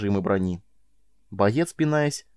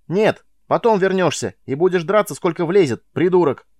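shouting; 7 semitones higher, 6 dB louder than usual. Acted. Miles yells at prisoner.

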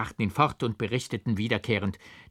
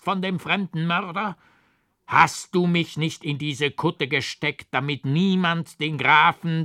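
higher, louder raging curse; terrible furious shout; 5 semitones higher, 5 dB louder than usual. A narrator's reading. Raving monk curses the old forester, furious shout. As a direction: hurl every word in fury, slowing at the threat. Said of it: "schrie mit fürchterlicher Stimme".

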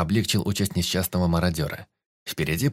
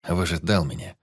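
plain reading voice

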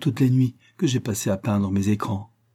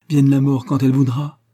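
louder, higher rapturous exclamation; ecstatic cry; 4 semitones higher, 6 dB louder than usual. A narrator's reading. Pauline in her lover's embrace, crying out ecstatically. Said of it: "s'écria Pauline en extase".

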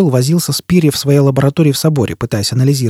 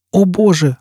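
higher startled exclamation; exclaiming; 8 semitones higher, slower than usual. Acted. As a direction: burst out in alarm. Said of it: "воскликнула Джизбелла". (испуганное восклицание)

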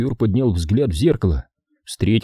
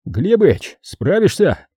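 plain reading voice

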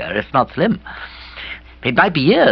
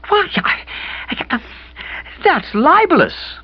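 high, breath-caught stammering, slowing on "libelous"; aghast stammer; slower than usual. A narrator's reading. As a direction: choke on the shock.

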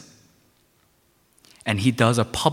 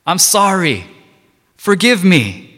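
rising, louder cries for mercy; pleading sinner; 8 semitones higher, 5 dB louder, slower than usual. Pretend. Repentant sinner calling out to Jesus, crying loud for mercy. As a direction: beg him for forgiveness, building louder. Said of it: "he called out to Jesus Christ".

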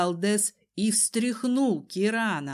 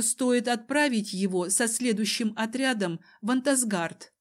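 ordinary delivery, nothing marked

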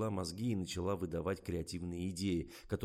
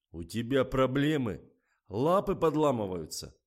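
loud, raised angry scolding; furious; 5 semitones higher, 9 dB louder than usual. A narrator's reading. Director loses his temper at the model, furious. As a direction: berate her at full voice. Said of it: "взбесился режиссёр".

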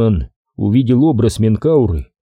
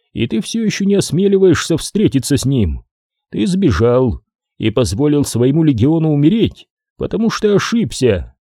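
prophetic trance voice; 4 semitones higher than usual. Acted. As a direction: speak as a distant accusing seer. Oracle voice accuses Ariman.

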